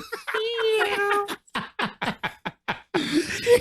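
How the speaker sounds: tiny high mewling yawn; kitten yawn imitation. Friend mimicking a kitten-like yawn, very high and soft. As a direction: squeak like a yawning kitten. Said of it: "He yawns like a kitten".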